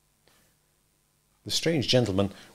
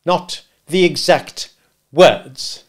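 slow, loud, word-by-word echo; scornful repetition; bitter; 5 semitones higher, 7 dB louder, much slower than usual. Acted. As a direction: throw the words back bitterly. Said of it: "repeated the gentleman bitterly".

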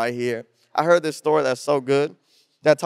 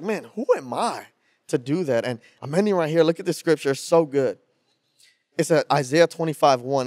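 normal talking voice

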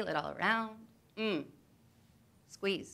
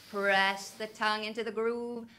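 ordinary talk, nothing marked

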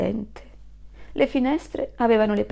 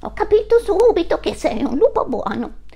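put-on higher, louder child voice; little goat; 9 semitones higher, 6 dB louder than usual. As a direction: say it with childish certainty.

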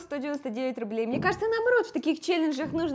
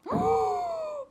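high sharp gasp of delight. Real, not acted. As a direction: gasp at the gift. Unwrapping gift.

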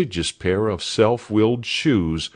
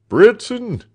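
high, loud shout for a servant; summoning shout across the yard; calling out; 13 semitones higher, 7 dB louder than usual. A narrator's reading. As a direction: cry the name out sharply, high and loud. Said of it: "cried the doctor".

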